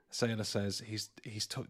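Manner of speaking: usual speaking voice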